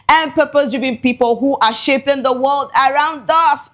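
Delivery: normal talking voice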